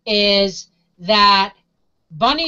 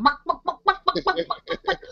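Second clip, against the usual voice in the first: soft, lightly higher clucking; imitated chicken cluck; 6 semitones higher, 6 dB quieter than usual. Recalling the rabbit in the ad, soft mock clucking. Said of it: "the rabbit was sitting there going".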